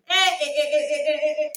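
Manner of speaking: a string of loud pained yelps. Retold or imitated. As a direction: stutter out loud little cries. Reliving the pain.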